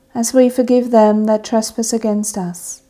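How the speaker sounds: ordinary talk, nothing marked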